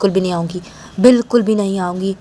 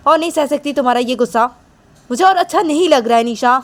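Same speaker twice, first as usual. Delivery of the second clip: higher, raised scolding voice; Manisha telling her off; 6 semitones higher, 3 dB louder than usual. Acted. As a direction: shut her up, voice lifted and fed up. ज़्यादा गुस्सा मत दिखाओ मुझे.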